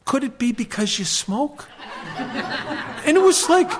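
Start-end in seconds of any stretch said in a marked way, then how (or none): 0.1-1.7 dry laughing suggestion. Retold or imitated. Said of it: "I said to him".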